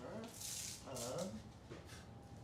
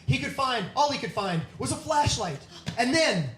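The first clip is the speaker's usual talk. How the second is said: loud, building spooky storytelling; loud dramatic narration; 6 semitones higher, 21 dB louder than usual. Acted. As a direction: ramp up the scare for the climax. Climax of scary story.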